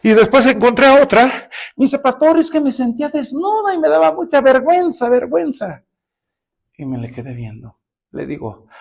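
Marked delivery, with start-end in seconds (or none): 2.0-5.8 lightly voiced young woman. Pastor voices a woman's confession.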